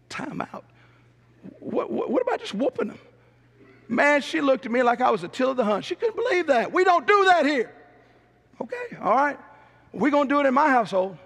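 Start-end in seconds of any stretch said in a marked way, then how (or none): 0.1-3.0 breathy stunned whisper of disbelief; Timeout? asked in a hushed, shocked whisper; incredulous whisper. Retold or imitated. Incredulous at tour guide's answer. 6.7-9.4 prim shocked guide. Retold or imitated. Mimics shocked tour guide.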